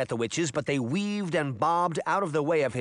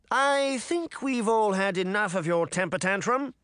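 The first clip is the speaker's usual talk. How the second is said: interrupting; 7 semitones higher than usual. A narrator's reading. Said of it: "Charming interrupted".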